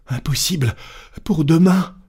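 high, agitated insistence; frantic servant. Acted.

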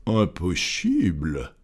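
composed gentleman's drawn-out voice; unruffled master. Acted. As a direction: repeat it slowly and coolly, dropping the pitch. Unruffled master echoes frantic servant.

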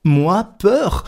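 high-pitched, affronted outcry; stung Passepartout, voice shooting up; indignant exclamation. A narrator's reading. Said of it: "s'écria Passepartout".

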